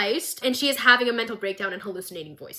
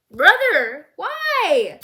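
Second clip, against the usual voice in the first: betrayed brother asking why, louder; hurt disbelief; 7 semitones higher, 6 dB louder, much slower than usual. Retold or imitated. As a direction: voice jumps up high on why. Victor confronting Jace.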